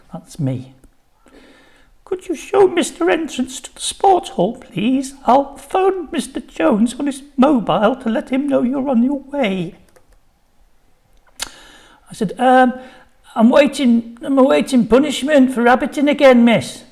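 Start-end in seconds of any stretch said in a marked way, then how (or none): 2.0-9.8 high, fluttery office lady voice; nervous secretary, pitched well up; flustered secretary. A narrator's reading. 12.4-16.8 high, loud cheeky schoolboy; boy pleading his case, pitched up. A narrator's reading. Boy answers the secretary.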